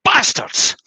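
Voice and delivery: high, hoarse, unvoiced curse; naked man blaming the moths; mock outburst. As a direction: spit it out at the moths. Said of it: "looks down and goes".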